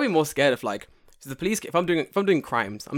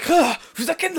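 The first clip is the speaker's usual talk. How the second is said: high startled squeal; 4 dB louder than usual. Retold or imitated. Kid recoils from kiss offer.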